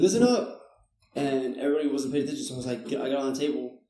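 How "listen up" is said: shouted command. Retold or imitated.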